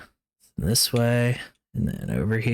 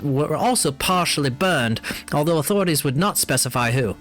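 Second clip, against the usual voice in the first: news read; 4 semitones higher than usual. Acted. Reads in-game newspaper article.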